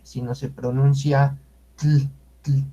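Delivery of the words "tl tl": tl, tongue on palate, gentle L; isolated tl sound. Shown as a demonstration. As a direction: release tl lightly on its own. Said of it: "pero suave la L".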